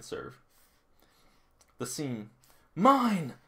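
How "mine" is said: high, loud call for the ball; mock callout. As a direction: shout claiming it. Voicing a player's call.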